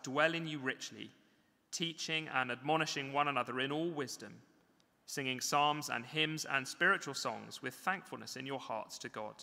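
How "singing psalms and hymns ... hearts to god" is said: ordinary talk, nothing marked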